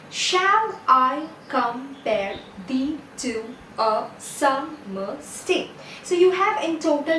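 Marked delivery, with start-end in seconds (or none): 0.1-5.7 slow, each syllable separated. Shown as a demonstration. Teacher counting ten syllables in the line for students, syllable by syllable. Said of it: "you have ten syllables in this line".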